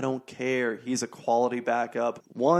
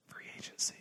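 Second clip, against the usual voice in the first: very soft, breath-only whisper; hushed teaser; 13 dB quieter than usual. Real, not acted. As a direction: whisper the topic. Teases next topic in a whisper.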